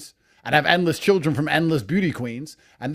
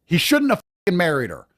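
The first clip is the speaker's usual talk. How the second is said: ordinary talk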